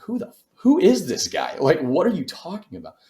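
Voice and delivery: imitating his own stunned outburst at a stranger; shocked disbelief. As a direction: retell the baffled reaction at the door, pitch jumping up. Confronted by stranger at door.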